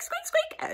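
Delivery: tiny high-pitched squeaks; hamster squeaks. Retold or imitated. Hamster answering the teacher in tiny high squeaks. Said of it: "it came out squeak".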